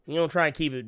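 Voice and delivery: usual speaking voice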